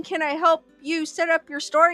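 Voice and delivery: usual speaking voice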